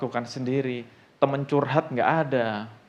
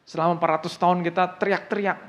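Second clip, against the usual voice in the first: questioning God; 4 semitones higher, 4 dB louder than usual. Acted. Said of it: "bertanya-tanya sama Tuhan".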